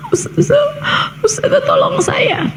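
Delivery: high, breathy sobbing between words; acted-out tearful voice, voice jumping high; imitated crying plea. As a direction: plead for help through mock tears, gasping. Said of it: "Terus dia nangis gitu".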